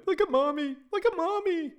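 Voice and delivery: put-on very high loud child's yell; high squealing kid shout; little girl shouting. A narrator's reading. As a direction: shout it like a gleeful four-year-old pointing.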